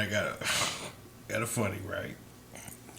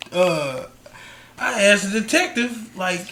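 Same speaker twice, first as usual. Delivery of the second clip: ordinary talk, nothing marked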